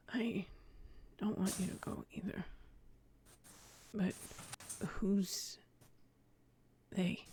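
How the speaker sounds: near-whispered, halting reply; soft, breath-heavy whisper to a loved one; hushed comforting. Acted. Comforting his frightened mother in character.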